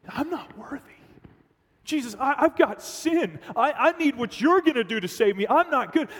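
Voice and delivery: high, pleading confession; John pleading. Pretend. John pleading with Jesus, high and earnest. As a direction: beg like an unworthy sinner.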